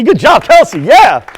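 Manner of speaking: high, loud cheer for Kelsey. Real, not acted. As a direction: shout the praise to the whole room. Cheering Kelsey on, repeated louder.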